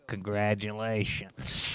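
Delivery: low, soft, drawn-out congratulation; grumpy old man. Retold or imitated. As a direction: imitate a grudging old man, slow and low. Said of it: "old grumpy dude in an old wrinkled suit".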